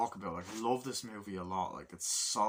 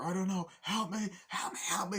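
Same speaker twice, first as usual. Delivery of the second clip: desperate plea; 10 semitones higher than usual. Retold or imitated. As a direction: beg for help, terrified. Dying woman pleading.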